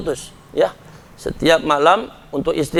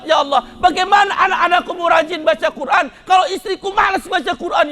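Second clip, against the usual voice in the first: tearful midnight prayer; 10 semitones higher than usual. Retold or imitated. Husband reenacting his tearful night prayer for his wife, high pleading cries. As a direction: beg God for his wife's faith, near tears. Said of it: "Saya selalu nangis tengah malam".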